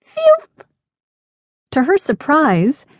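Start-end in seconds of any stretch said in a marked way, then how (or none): 0.0-0.4 very high, short "phew"; a feeble attempt at a bird whistle; high, loud little puff of a whistle; sad failed whistle. A narrator's reading. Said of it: "all that came out was a sad".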